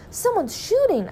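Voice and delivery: very high, sudden exclamation; alarmed cry. A narrator's reading. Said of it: "I cried".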